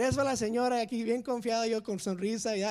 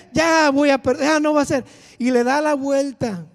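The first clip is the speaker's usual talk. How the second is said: loud, higher despairing cry; loud panic; 4 semitones higher, 13 dB louder than usual. Retold or imitated. Fearing failure on the exam, loud despair. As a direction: shout it like all is lost. Panics before turning page.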